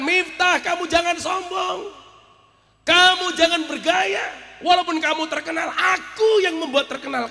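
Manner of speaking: high, loud shouted warning; preacher shouting a rebuke in God's words; imagined divine rebuke. Retold or imitated. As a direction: thunder it like God scolding a proud servant. Voices God rebuking the preacher.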